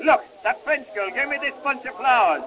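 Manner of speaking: ordinary talk, nothing marked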